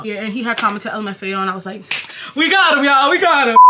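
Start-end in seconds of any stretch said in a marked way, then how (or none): 2.3-3.6 loud, high gloating cheer; triumphant. Retold or imitated. Telling her followers she hooked him, loud triumphant cheer. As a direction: crow it like you just won. Triumph when crush comments.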